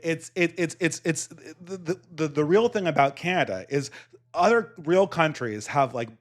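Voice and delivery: ordinary talk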